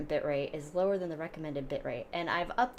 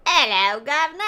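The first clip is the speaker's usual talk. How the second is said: hammed-up Cockney "Hello, governor", higher and louder; Cockney accent; 8 semitones higher, 11 dB louder than usual. Shown as a demonstration. Wife contrasting Cockney with posh British, in hammed-up Cockney. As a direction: do a cheeky London street Cockney greeting. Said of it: "Cockney's the, like".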